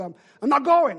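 high, loud acted refusal; exasperated protest, hammed-up; frustrated refusal. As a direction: play a fed-up tantrum, digging in. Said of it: "I was frustrated".

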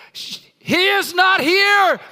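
high, loud, slow wake-them-up shout; full-throated proclamation. Shown as a demonstration. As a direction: shout it to wake your neighbor. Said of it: "shout it like you need to wake the person".

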